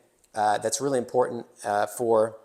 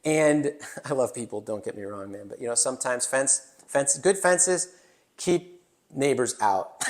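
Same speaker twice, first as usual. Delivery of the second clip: ordinary delivery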